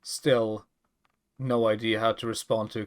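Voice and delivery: normal talking voice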